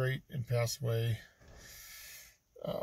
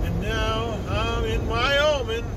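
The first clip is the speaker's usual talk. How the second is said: higher, louder, drawn-out silly voice; odd put-on voice; 6 semitones higher, 5 dB louder than usual. Vlogger saying goodbye to Denver to viewers, in a silly put-on voice. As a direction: slip into a strange new voice for the camera. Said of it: "I don't know why I'm talking like this".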